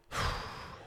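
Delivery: soft, breath-only sigh; exasperated sigh. Real, not acted. Vlogger reacting to the store owner's post, exasperated sigh. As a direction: let out a weary breath.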